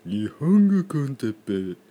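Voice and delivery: dodgy, put-on show title; hammed-up shifty voice; suspicious voice. Shown as a demonstration. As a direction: say the title like a shady character. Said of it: "ちょっと怪しいですね".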